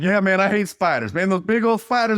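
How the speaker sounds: high, loud, excited agreement; eager player. Retold or imitated. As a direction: pile on like a keyed-up teammate. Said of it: "this other guy's like".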